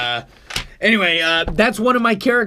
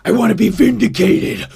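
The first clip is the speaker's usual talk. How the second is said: the prepper repeats it, louder and pressing harder; prepper insisting; 3 dB louder than usual. Acted. Prepper character repeating his wish, louder and more insistent. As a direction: insist on it again, with a bit more push. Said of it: "I have another guy. He's an off-the-grid guy".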